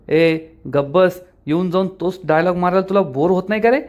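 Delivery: ordinary delivery, nothing marked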